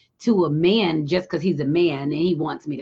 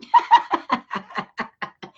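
high burst of laughter. Real, not acted. Laughs at own story.

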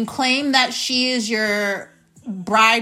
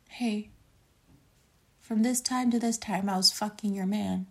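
hushed retold confession; 3 semitones lower, 9 dB quieter than usual. Retold confession call to girlfriend.

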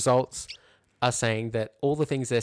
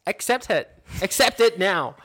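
higher, louder mock order; mocking the platitude, louder; mock condescending; 7 semitones higher, 7 dB louder than usual. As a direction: parody the preachy advice-giver. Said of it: "condescending platitude".

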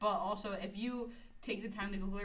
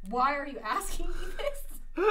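loud, high, drawn-out indignant retort, acted out; aggressive retort; 7 semitones higher, 9 dB louder, slower than usual. Student snapping back at a teacher, acted-out aggressive retort. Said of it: "aggressively like".

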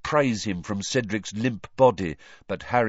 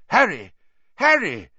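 loud, high calls of his name; urgent calling; 9 semitones higher, 7 dB louder than usual. A narrator's reading. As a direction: call out to rouse him. Dumbledore calls to dazed Harry.

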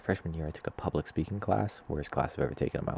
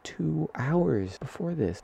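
ordinary delivery

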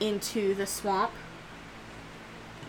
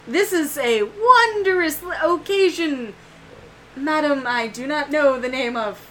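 courtly Braxis; 5 semitones higher, 5 dB louder than usual. Acted. Knight greeting the new mother, high courtly voice. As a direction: greet her with grand courtesy. He looks over and he goes.

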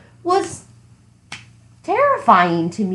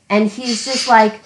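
ordinary talk, nothing marked

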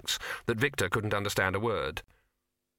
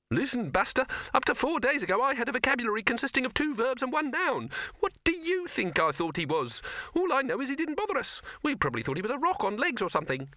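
very high, cocky rabbit voice; indignant rabbit. Acted. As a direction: lecture him in a squeaky rabbit voice.

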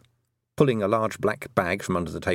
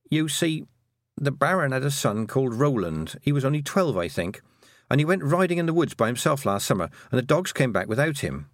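put-on higher-pitched girl character, explaining; earnest young Tiffany; 6 semitones higher than usual. Girl explaining the Baron's son's disappearance, earnest. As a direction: voice an earnest girl telling what happened.

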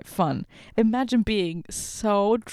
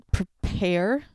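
usual speaking voice

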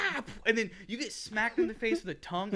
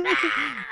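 mock scream; 9 semitones higher, 7 dB louder than usual. Hero running around screaming.

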